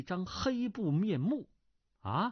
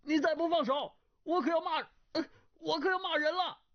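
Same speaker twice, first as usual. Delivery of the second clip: calling out; 14 semitones higher, faster than usual. A narrator's reading. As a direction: call out a flustered warning. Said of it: "叫道". (气急地高声叫喊)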